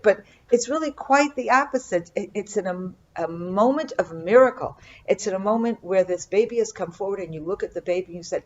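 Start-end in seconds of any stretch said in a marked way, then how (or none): none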